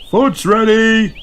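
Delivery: dad calling everyone to eat. A narrator's reading. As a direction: sing it out across the garden. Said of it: "calls Mister Kangaroo".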